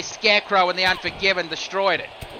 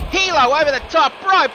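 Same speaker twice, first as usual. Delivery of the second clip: higher and louder, calling the dive; excited call; 9 semitones higher, 6 dB louder than usual. Acted. Commentator calling a dive over the top rope, excited call. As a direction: sell the big aerial move. High-flying move called live.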